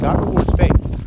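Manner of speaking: loud, slow proclamation; emphatic proclamation. Acted. Preacher proclaiming to the congregation, loud and slow. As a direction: proclaim it slowly and strongly.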